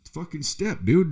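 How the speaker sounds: high-pitched, annoyed retelling; retold warning. To a man crowding him in line, high retold warning.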